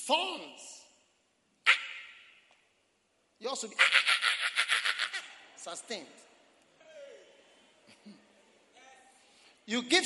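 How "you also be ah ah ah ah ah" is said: mock pained cries. Acts out pain of thorns.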